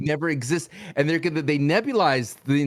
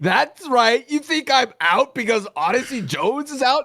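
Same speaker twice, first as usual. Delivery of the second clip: hyped defiance; 8 semitones higher, 5 dB louder than usual. Acted.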